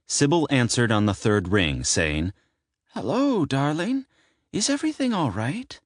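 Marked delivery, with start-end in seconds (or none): 2.9-5.8 high, drowsy aunt voice; just woken by a midnight call; sleepy. Acted. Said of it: "in a sleepy voice".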